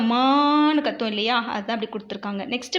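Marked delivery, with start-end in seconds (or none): 0.0-0.8 long, drawn-out cow call; cow's moo. Retold or imitated. Teacher showing children how a cow calls, drawn-out moo imitation. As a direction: imitate a cow lowing, stretching the vowel. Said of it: "எப்படி கத்தும்".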